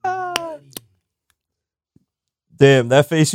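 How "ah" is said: usual speaking voice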